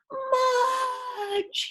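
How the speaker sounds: high, long call of the name. Acted. Boy's last call for his lost dog, high and drawn out. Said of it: "he called one last time".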